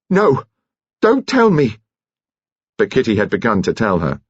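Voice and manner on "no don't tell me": high-pitched cry of refusal; alarmed shout. A narrator's reading. Nurse begs not to be told, alarmed shout. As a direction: cry out to stop her, pitch shooting up. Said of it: "she shouted".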